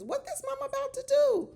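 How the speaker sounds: high, loud, astonished question; shocked question. Real, not acted. Vlogger shocked at what the mama might do, high and loud. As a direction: voice shooting up in disbelief.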